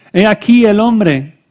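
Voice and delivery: high, ringing announcement; loud proclamation. A narrator's reading. Pilate presenting Jesus to the crowd, loud proclamation. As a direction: present him loudly to a crowd.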